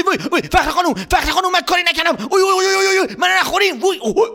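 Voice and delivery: high, panicked wailing; high frantic boy's panic; terrified cry. Acted. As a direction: scream in fright and flee. Boy terrified, then runs away.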